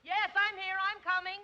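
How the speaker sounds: calling back. Acted. Answering call from afar.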